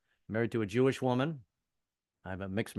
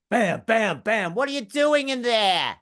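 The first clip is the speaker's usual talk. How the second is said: loud pounding wife; 10 semitones higher, 8 dB louder, slower than usual. Retold or imitated. Wife banging on bathroom door.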